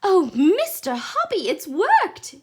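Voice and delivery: high and loud, overjoyed; joyful outcry; excited cry. A narrator's reading. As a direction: cry it out in delight. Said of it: "she was crying".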